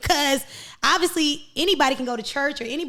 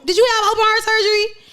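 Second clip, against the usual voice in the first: challenging shout; 8 semitones higher, 5 dB louder, faster than usual. Real, not acted. Challenging question.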